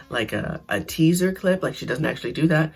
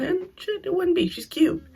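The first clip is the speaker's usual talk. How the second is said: high excited. Real, not acted. Gushing over rival's looks.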